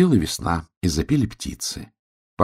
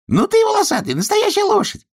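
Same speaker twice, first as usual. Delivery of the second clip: excited squeal; 4 dB louder than usual. Acted. Duck joyful, very high.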